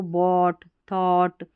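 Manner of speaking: बॉट, थॉट with long "aw" straight into t; silent GH. Shown as a demonstration. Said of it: "जीएच का उच्चारण नहीं हो रहा".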